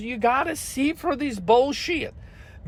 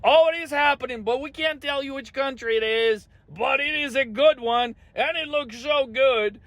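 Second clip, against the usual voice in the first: higher, gushing sarcastic sales pitch; mock official spin; 6 semitones higher than usual. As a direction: parody a salesman hyping a deal.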